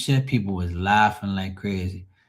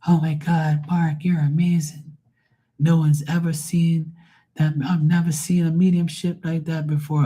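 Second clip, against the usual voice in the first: higher, gushing fan voice; gushing bestie; 7 semitones higher than usual. Retold or imitated. Bestie gushing to Mark about his reading, higher and gushing. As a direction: gush over him like a starstruck friend. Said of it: "her bestie loved me".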